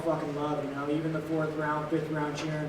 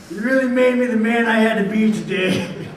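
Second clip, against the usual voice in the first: loud mock-grateful yell at his past; sarcastic shouting; 4 semitones higher, 8 dB louder than usual. Real, not acted. Fighter mockingly thanks his father, loud sarcasm. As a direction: shout thanks to the abuse with bitter irony.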